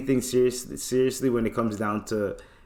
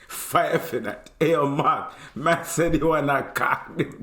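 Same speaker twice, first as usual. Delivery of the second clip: put-on Jamaican accent; 4 semitones higher than usual. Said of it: "He goes".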